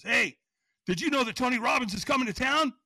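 high, loud retold burst of news; excited phone call. Buddy calls about Tony Robbins coming, excited. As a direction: imitate a thrilled friend on the phone.

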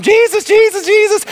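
high, eager calling from the crowd. Acted. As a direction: call his name over a jostling crowd.

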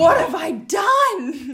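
slow, loud panicked cry; alarmed shout. Retold or imitated. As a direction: shout it in horrified disbelief. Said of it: "I was like".

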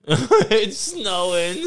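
high, slow laughing repeat; can barely get it out for laughing; laughing echo. Real, not acted. Friend echoing the snowing line, laughing hard.

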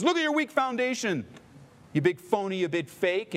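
high, mocking taunt; taunting enemy. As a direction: sneer it like the accuser. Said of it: "the enemy comes in and says".

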